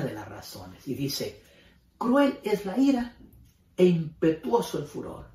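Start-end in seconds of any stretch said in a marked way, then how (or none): 2.0-5.3 forceful scripture reading. Acted. Pastor reads verse on wrath.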